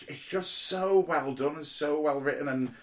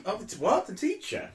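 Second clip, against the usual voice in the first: much higher, puzzled question; surprised query; 10 semitones higher than usual. Real, not acted. Asks co-host which character is meant, surprised. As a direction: pitch leaping up in puzzlement. Taken aback by co-host's teacher comparison.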